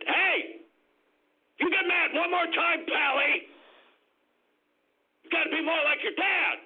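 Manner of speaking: high, hammed-up scolding dad voice; mock harsh dad. Preacher mocking a bad father scolding his angry son, in a high hammed-up voice. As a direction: squeak out a blustering dad threatening his son.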